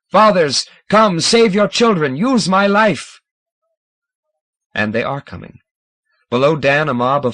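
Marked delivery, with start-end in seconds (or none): 0.1-3.2 loud, higher summoning cry; triumphant summons. A narrator's reading. Scomber summoning the fathers, triumphant and loud. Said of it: "Scomber's triumphant summons".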